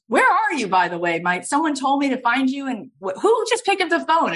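high, insistent caller on the phone; Steven Spielberg. Retold or imitated. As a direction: act out a director demanding answers, pitch jumping up. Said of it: "He goes".

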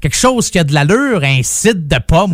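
ordinary delivery

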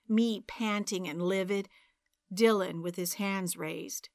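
usual speaking voice

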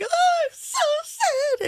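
high, slow exaggerated wail; mock crying. Explaining her cry face to the others, mock wailing. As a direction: drag it out like a sobbing cry face. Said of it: "my cry face is like".

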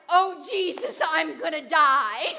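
loud panicked "I'm gonna die"; panicked cry. Retold or imitated. Swept down the river, she cries out in panic. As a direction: cry out in mock terror, slow and loud. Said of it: "And I'm like".

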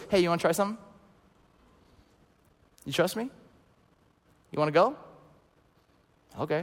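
soft coaxing questions, long pauses between; quiet, inviting voice of God, lightly acted; gentle divine invitation. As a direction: invite them gently, leaving space after each question. God voiced gently inviting, after loud build.

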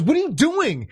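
startled partner. Retold or imitated. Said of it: "she goes".

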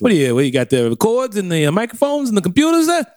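high, nosy New York patter; New York construction worker. Pretend. Worker eyeing the recording gear, nosy New Yorker. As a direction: pile up questions like a worker butting in. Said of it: "turned into a New York construction worker".

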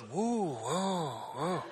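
imitated low crowd "whoa"; low, swooping oohs of a scandalized class; shocked class. Classmates reacting to a student's outburst, imitated shocked oohs. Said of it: "And everyone goes".

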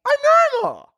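very high, loud squeak; squeaky voice. Acted. Comic insisting to viewers bat-hanging is regular, loud squeak. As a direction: squeal it out sarcastically.

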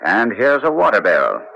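ordinary talk, nothing marked

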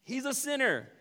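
the grumbling crowd, smugly judging Zacchaeus. Retold or imitated. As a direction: dismiss him with a sniff. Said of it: "the smug religious undertone".

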